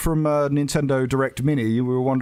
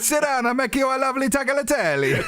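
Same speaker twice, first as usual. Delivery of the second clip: mock Italian accent; 11 semitones higher than usual. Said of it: "this accent".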